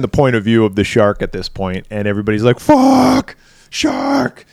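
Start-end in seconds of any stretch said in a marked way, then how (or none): 2.6-4.4 high, loud crowd alarm; high-pitched warning shout; mock panicked cry. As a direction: cry it out like a swimmer spotting danger. Crowd panicking at shark sighting.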